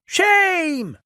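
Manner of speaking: high squeaky protest; tiny indignant squeak. A narrator's reading. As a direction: squeak it out like a small engine. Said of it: "squeaked Percy".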